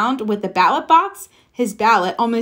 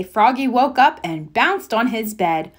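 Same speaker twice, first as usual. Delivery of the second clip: ordinary delivery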